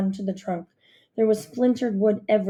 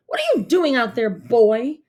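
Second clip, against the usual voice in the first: put-on louder, stern shout at the boy; 3 semitones higher, 5 dB louder than usual. A narrator's reading. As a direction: scold the boy from the tree. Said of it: "the Duke shouted at me".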